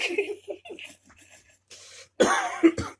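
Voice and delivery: high shrieking laugh; squealing laugh fading; high laugh fit. Real, not acted. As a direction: dissolve into laughter.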